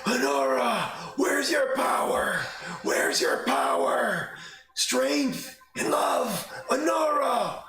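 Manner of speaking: high, loud desperate calling out; old man shouting. Acted. Old man calls out to Honora, a loud cry. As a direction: shout her name to wake her power. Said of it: "the old man say out loud".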